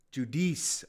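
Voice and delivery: "Judice" said joo-DEECE; corrected pronunciation. Shown as a demonstration. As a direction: stretch the second syllable of "Judice" as DEECE. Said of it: "I really screwed that up".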